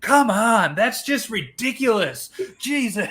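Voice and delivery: high, exasperated, drawn-out complaint; disgusted teasing. Retold or imitated. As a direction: tease him with mock disgust. Friends mocking his gas.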